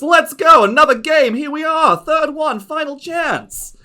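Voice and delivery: high, loud gushing diva; gloating aunt voice. Acted. Lady Ziza taunting the player at the dice table, high gushing diva.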